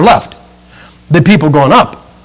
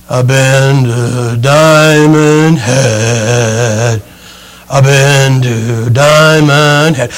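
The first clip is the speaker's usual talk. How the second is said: very slow, lower, dragging exhausted drone; worn-out hikers; 5 semitones lower, much slower than usual. Retold or imitated. Preacher imitates spent hikers coming down, slow exhausted drone. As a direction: drag each word out like you can barely stand. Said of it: "And then there's the people coming down".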